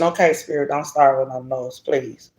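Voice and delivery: low, soft plea; quiet aside. Real, not acted. Reader asking Spirit not to start, quiet aside. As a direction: drop the voice for a private word.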